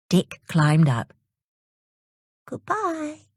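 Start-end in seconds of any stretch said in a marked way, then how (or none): 2.4-3.3 put-on much higher, softer gentle farewell from the mermaid girl; a sweet lightly voiced sea girl's "Goodbye"; Pearl calling goodbye. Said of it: "called Pearl".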